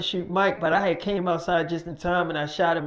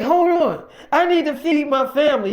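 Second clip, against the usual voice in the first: loud, much higher pleading; desperate plea; 7 semitones higher, 6 dB louder than usual. Retold or imitated. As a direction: beg to feed your family. Worker pleading for food.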